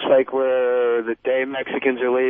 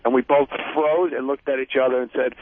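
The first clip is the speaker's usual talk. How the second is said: ordinary talk, nothing marked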